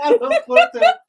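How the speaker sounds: high, loud laughing words; cracking up mid-sentence. Real, not acted. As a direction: squeal it out through laughter. Cracking up at a joke.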